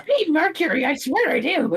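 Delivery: high cartoon bird; squeaky high creature; Skarmory voice. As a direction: plead in a silly high voice. Game master still in creature voice.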